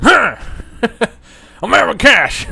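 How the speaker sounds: loud, slow, drawn-out character voice; Aaron Cash. Acted. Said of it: "Still love your Aaron Cash impression".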